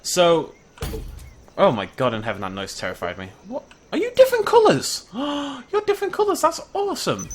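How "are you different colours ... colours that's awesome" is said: high squeaky delight at the colours; excited squeal. Real, not acted. Spotting colourful dinosaur, high excited squeal. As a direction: gasp and squeal with glee. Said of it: "that's got me so excited".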